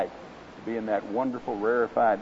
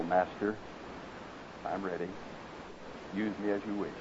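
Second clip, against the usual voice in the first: much softer and lower, barely there; low, humble voice; 5 semitones lower, 13 dB quieter than usual. Acted. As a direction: yield quietly, a surrendered servant. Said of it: "Bamboo's voice came low".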